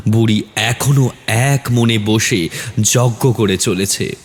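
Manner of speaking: ordinary delivery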